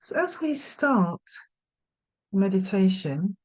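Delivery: unhurried meditation guidance with long pauses; guided meditation. Real, not acted. Yoga nidra teacher settling listeners for rest, guided meditation. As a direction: gently talk them into rest, leaving space after each instruction.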